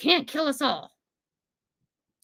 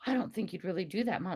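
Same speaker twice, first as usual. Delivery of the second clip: quick, softer, lower quoted aside; imitating doubtful daughter; 4 semitones lower, 11 dB quieter, faster than usual. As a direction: play back her doubt, low and fast.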